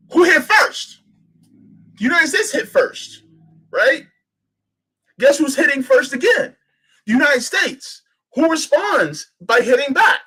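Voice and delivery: fired-up rhetorical shouting; heated emphasis. Real, not acted. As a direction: drive home who hit first. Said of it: "heated rhetorical questions".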